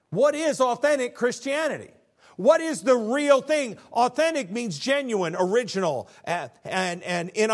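loud, high preacher's cry; preaching at full force. Acted. Pastor crying out to the congregation, loud and high. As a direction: lift the question to the rafters.

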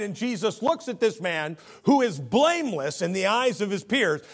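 rising, fervent preacher voice; preaching. Acted. Preaching at full force.

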